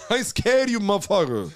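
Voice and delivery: quoting Bernie Mac's stand-up catchphrase. Retold or imitated. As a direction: defy the crowd like Bernie. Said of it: "I fucking love Bernie Mac".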